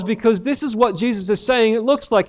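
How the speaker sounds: usual speaking voice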